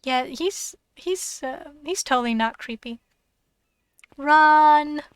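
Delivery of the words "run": loud urgent yell; shouting. Acted. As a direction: yell it in panic. Panicked cry to flee.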